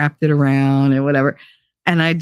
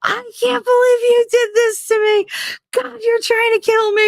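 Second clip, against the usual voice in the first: very high, wailing mock meltdown; hammed-up panicked wail; freaking out. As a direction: imitate a hysterical ex, squealing and near tears. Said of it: "he's freaking out".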